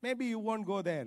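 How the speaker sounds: ordinary talk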